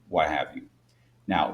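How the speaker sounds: ordinary delivery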